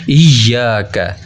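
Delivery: इय्याका with a heavy doubled y, slow and drawn out; इय्याका slowly with the y held and stressed. Shown as a demonstration. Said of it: "इय्या stress के साथ पढ़ेंगे हम".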